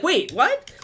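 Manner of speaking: high-pitched retold double take; confused surprise. Streamer retelling their confusion at a message, baffled surprise.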